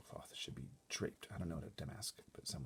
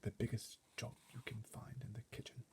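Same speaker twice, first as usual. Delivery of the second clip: higher soft whisper; 4 semitones higher, 6 dB quieter than usual. A narrator's reading. Butler sending footmen to the kitchen, whispering. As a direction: breathe the request. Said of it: "he whispered".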